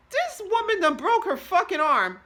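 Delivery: high, loud exclamation of alarm; loud disbelief. Acted. Realizing the shoulder is broken, loud disbelief. Stunned thought acted out.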